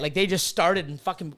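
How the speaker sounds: ordinary delivery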